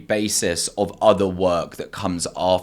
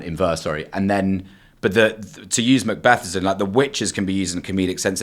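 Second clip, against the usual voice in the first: usual speaking voice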